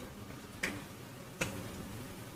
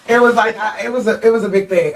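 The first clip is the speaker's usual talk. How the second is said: laughing through the words; giggling embarrassed confession; 6 semitones higher than usual. Real, not acted.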